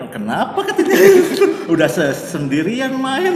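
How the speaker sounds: high, loud laugh breaking through the words; laughing mock question. Real, not acted. Joking that the others would ask why play alone, laughing out loud. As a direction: crack up while quoting the onlookers.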